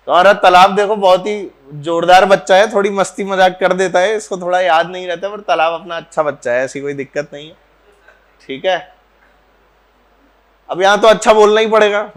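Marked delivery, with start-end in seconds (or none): none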